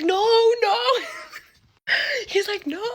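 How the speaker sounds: imitating a cousin's loud protest; mock pleading yell; retold yelling. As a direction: yell a refusal, as if fighting over it. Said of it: "I hear them yelling".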